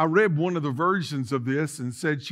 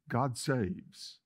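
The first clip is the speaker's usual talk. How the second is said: ordinary delivery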